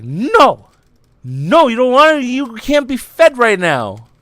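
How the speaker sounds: mommy bird. Pretend. Mommy bird refusing to feed her chick, loud and firm. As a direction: firmly refuse the chick like a bossy mom. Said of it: "the mommy bird was like".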